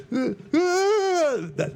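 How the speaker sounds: a quick burst of mock panic; freaking out voice. Shown as a demonstration. Host demos his freak-out voice for listeners. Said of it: "go-to freaking out voice".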